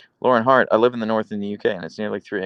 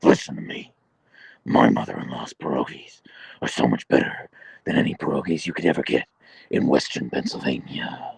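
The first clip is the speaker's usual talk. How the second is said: low, rasping superhero growl; Batman; 9 semitones lower than usual. Acted. Streamer acting out Roni's pierogi boast, Batman voice. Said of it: "doing that in a Batman voice".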